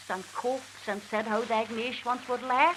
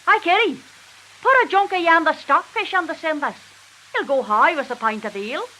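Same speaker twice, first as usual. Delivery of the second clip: loud call from afar; husband calling out; 4 semitones higher, 7 dB louder than usual. Retold or imitated. Husband shouting to wife from outside, loud call. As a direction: shout across the yard to her. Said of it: "our Tubman shouts from the laugh".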